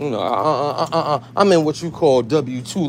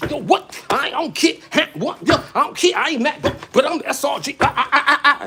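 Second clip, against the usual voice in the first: imitating a hyped rapper; 10 semitones higher than usual. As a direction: ham up a cocky rapper daring anyone. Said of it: "he was feeling himself".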